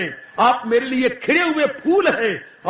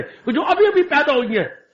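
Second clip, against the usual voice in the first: usual speaking voice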